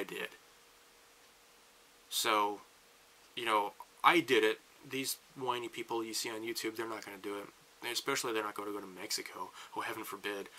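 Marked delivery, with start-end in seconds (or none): none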